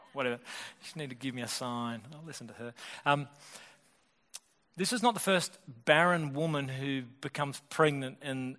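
ordinary delivery

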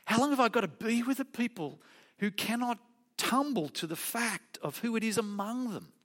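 lightly voicing Jesus' frustration at the crowd; exasperated Jesus. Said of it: "The point he is making is".

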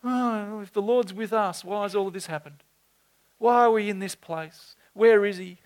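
a hint of high complaining; lightly mock his doubting questions; mild whining Gideon. Preacher imitates Gideon asking the angel, mildly whiny. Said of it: "and Gideon says".

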